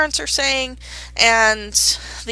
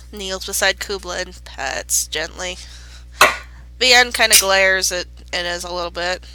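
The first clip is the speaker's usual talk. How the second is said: ordinary talk, nothing marked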